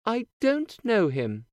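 put-on high, bright girlish princess voice; cartoon princess. A narrator's reading. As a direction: play a sweet young princess. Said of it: "the princess says".

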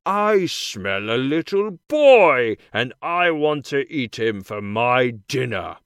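loud, menacing big man hunting his dinner; giant's shout. A narrator's reading. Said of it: "The big man comes home and shouts again".

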